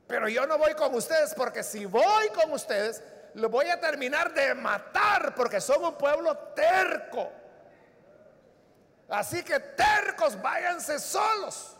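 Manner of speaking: loud, stern rebuke as God. Acted. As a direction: scold a stubborn people from on high. God voiced rejecting stubborn people.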